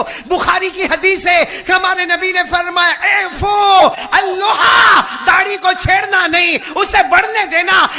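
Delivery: loud, high fervent recital of saints; impassioned shouted sermon; full-force preaching. Acted. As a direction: thunder each name to the crowd.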